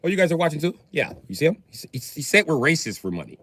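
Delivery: ordinary talk, nothing marked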